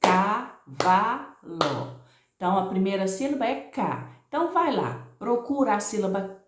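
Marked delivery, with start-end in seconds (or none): 0.0-2.0 CA... VA... LO, very slow with clear gaps; split into syllables. Shown as a demonstration. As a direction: separate CAVALO into three long, distinct syllables. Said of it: "Se você separar a palavra cavalo".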